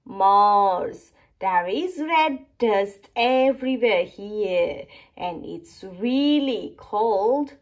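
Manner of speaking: plain reading voice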